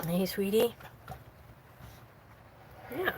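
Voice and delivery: usual speaking voice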